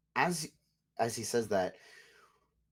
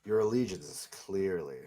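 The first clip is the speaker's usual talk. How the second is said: cold noble lord, menacing. Acted. GM voices lord character.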